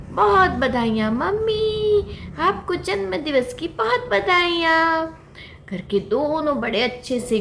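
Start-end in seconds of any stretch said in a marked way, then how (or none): 0.1-5.1 high, bright birthday greeting; child wishing its mother happy birthday; happy fawn. Acted. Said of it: "mummy को जन्मदिन की बहुत बधाइयाँ दी". (ख़ुश बच्चे की ऊँची आवाज़)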